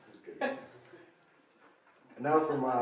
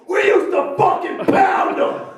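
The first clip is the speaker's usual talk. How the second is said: very slow, high, loud yell; slow party bellow; 11 dB louder, slower than usual. Acted. Comic bellowing his catchphrase, slow loud yell. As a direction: drag out the catchphrase full volume. Fake movie title yelled.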